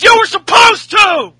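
mock yell. Jericho yelling back at Owens, mock yell. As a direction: fire it back at full volume. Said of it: "Jericho says".

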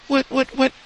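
very high repeated squeak; mock startled. Someone caught asking privately, high mock-startled squeak. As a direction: squeak each word in put-on alarm.

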